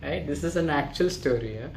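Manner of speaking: ordinary delivery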